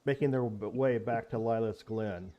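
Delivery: steady storyteller's narration; story narration. A narrator's reading. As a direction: set the scene like a game master. GM narrates the scene.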